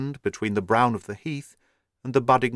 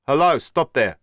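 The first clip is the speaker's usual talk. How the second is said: louder shouted challenge; shouting; 4 semitones higher, 5 dB louder than usual. A narrator's reading. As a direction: call out to halt the cart. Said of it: "he shouted".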